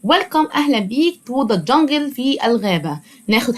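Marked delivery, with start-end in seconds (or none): none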